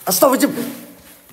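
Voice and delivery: startled prayer blurted high and quick; startled gasp. Real, not acted. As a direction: blurt it out in sudden fright. Startled by a figure in the dark.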